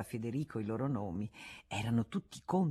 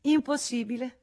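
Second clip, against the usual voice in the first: high, breezy refusal; Rosanette's voice; 9 semitones higher, 5 dB louder than usual. Acted. Rosanette turns down Cisy's dinner invitation, high and breezy. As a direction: brush off the invitation lightly. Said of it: "rispose la marescialla".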